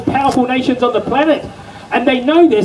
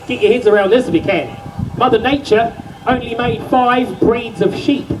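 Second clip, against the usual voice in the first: ordinary talk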